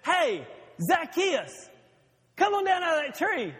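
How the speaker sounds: high, slow call up to the tree; Jesus calling. Retold or imitated. Jesus calling Zacchaeus down from the tree, high and slow. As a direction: call him down from the branches, drawn out and high. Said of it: "He says".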